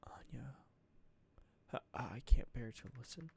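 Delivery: breathy hushed whisper. Acted. Character whispering Anya's name in dread. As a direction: whisper it, barely voiced.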